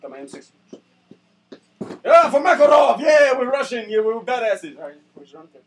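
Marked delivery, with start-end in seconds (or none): 2.0-4.8 loud, cheering shouts fading; rally the squad like a hyped thug; mock war cry. Gunman cheering for Makarov, loud war cry.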